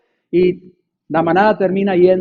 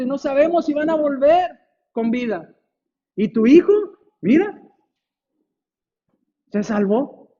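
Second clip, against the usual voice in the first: envious neighbours; 7 semitones higher than usual. Acted. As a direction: plead half-crying that his son was spared. Weeping neighbours envy his luck.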